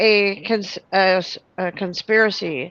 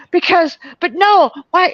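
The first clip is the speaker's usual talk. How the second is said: frantic; 7 semitones higher than usual. Retold or imitated. Retold freaking out.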